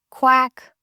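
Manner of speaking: high, loud quack at the door; duck quack. A narrator's reading. Duckling knocking at the hut, a loud high quack. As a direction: call out a quack to be let in. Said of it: "said he".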